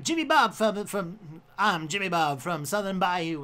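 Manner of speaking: hammed-up bayou Southern accent; loud Southern accent demo. Shown as a demonstration. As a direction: play a Southern good old boy. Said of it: "there's, like, a southern accent".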